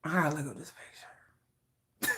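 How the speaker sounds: hushed secret whisper. Real, not acted. Fan whispering to herself while checking the picture. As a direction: whisper it under your breath. Excited fan hiding from chat.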